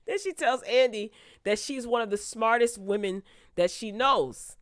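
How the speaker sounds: ordinary talk, nothing marked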